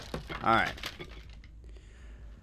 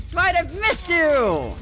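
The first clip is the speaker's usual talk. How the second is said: creepy taunting; 9 semitones higher, slower than usual. Pretend. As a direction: stretch it out, stalking him. Taunting the survivor.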